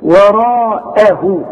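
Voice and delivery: وراءه drawn slowly, with the lips spread wide in a smile. Shown as a demonstration. Teacher shows the student the smiling lip shape. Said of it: "رجع شفايفك على هيئة ابتسامة".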